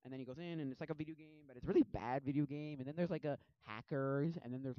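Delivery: ordinary delivery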